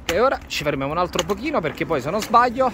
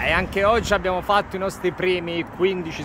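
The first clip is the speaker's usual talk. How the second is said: normal talking voice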